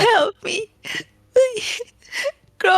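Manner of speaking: broken sobbing call to Grom; crying plea. Acted. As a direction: beg through the tears. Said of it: "crying again and go like".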